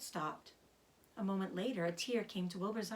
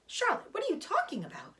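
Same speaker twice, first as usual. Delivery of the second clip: high, startled cry of disbelief; alarmed cry; 14 semitones higher than usual. A narrator's reading. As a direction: Wilbur leaping up in shock. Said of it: "he cried".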